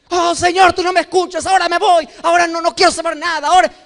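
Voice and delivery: high, fast, pouting; sulking tantrum prayer. Retold or imitated. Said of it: "hacemos ese tipo de cosas".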